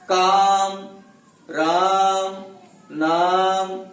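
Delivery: काम, राम, नाम with a loud, held आ; vowel stress. Shown as a demonstration. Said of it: "आ स्वर पर बल अधिक पड़ने के कारण".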